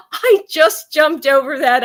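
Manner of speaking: high, loud, laughing words; laughing protest. Real, not acted. Retelling her reply to him, laughing protest. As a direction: protest through a burst of laughter.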